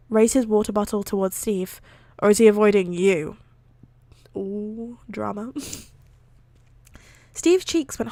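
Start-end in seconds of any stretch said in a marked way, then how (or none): 2.2-3.4 teasing. Acted. Teasing quote in fanfic reading. 4.3-5.5 hushed ooh. Real, not acted. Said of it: "reader's own aside".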